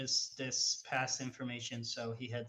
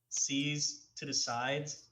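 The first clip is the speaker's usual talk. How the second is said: ordinary talk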